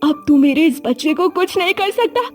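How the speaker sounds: high, hurt and accusing; betrayed young wife. Acted. Diya accusing the baba and her mother-in-law, high and hurt. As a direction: confront them in a high, betrayed voice.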